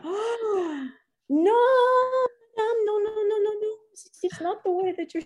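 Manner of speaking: high-pitched bad French accent. Race officials stopping the rider, in a bad French accent. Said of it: "bad French accent".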